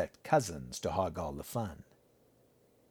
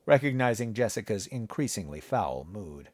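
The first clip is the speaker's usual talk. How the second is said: ordinary delivery, nothing marked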